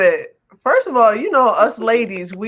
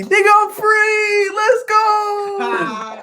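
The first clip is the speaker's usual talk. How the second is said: high, drawn-out victory shout; jubilant cry; 11 semitones higher, much slower than usual. Retold or imitated. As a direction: whoop it out, stretching the last words. Re-enacting joy at ban lifted.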